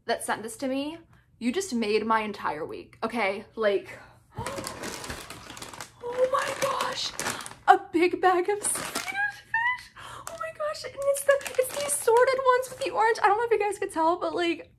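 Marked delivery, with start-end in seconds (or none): 4.2-7.1 high, drawn-out whisper of shock; gasp, then squeak it in disbelief; overwhelmed gasp. Real, not acted. Finds favorite candy in the box. 7.7-14.7 high-pitched, overwhelmed excitement; tearful joy. Real, not acted. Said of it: "I have tears in my eyes".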